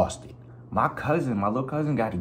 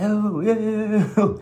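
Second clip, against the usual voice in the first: high, loud frantic yeah; mock panic; 14 semitones higher, 5 dB louder, slower than usual. Feeling something grab his ankle mid-river, high frantic yeah. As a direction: blurt it out thinking something grabbed you. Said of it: "I'm like".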